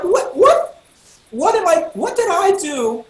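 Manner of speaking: high, loud mock innocence; hammed-up bewildered defense; baffled protest. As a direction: stammer it out, pitch way up. Retells baffled protest to friend.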